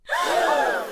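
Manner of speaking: high, sharp collective-style gasp; big breathy gasp of disbelief; shocked gasp. Acted. Everyone gasped at the retort.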